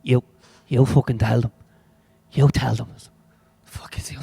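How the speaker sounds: lightly muffled background bickering; muffled Dublin voices. Acted. Staff arguing over who announces, lightly muffled Dublin bickering. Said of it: "two muffled Dublin voices in the background arguing".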